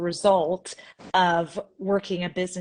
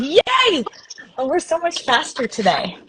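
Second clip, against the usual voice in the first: excited cheer; 8 semitones higher, 5 dB louder than usual. Real, not acted. Cheering start of show.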